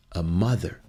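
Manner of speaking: ordinary talk, nothing marked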